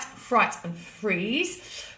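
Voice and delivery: slowly and deliberately, getting the tongue twister right; careful slow correction. Real, not acted. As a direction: spell out fright and freeze one careful word at a time. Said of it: "God, that's such a tongue twister".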